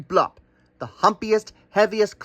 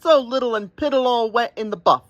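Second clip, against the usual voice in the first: a much higher, teasing ram voice; mocking ram; 9 semitones higher than usual. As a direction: poke fun at the wet little buffalo.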